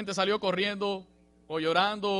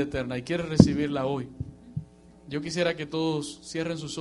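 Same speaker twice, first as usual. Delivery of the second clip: louder, urgent appeal building to a call; altar call preaching; 5 dB louder than usual. Acted. Preacher inviting the congregation to receive Jesus, urgent. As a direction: invite them forward with rising urgency.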